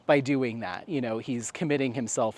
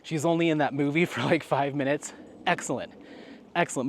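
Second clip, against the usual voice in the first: ordinary delivery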